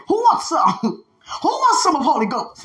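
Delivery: high-pitched mock fighting challenge; Debo. Speaker imitating a street bully, mock challenge. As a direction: call out for a fight, hammed up. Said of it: "Many of us like Debo out here".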